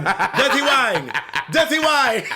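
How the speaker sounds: loud, laughing call of the dog names; laughing shout. Pretend.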